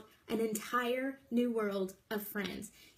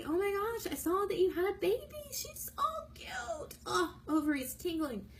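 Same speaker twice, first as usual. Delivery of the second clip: put-on much higher, fast, squealing over a baby photo, dropping to a breathy squeal; gushing excitement; 6 semitones higher than usual. Shown as a demonstration. Voice message to a friend about her new baby, gushing. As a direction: coo like you can't handle how cute the baby is.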